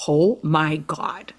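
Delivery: slow, word-by-word amazement; stunned disbelief. Retold or imitated. Woman hearing she may test for Miss October, stunned. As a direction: let each word land in mild shock. Retold stunned reaction to voicemail.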